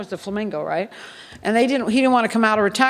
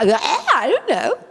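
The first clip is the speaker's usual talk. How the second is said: playing dumb; 6 semitones higher than usual. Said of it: "I'm just really du".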